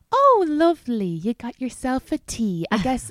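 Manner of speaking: high, bright mock-sweet passive-aggressive voice; sugary politeness hiding irritation; fake-sweet passive aggression. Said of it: "it's, like, said with a smile".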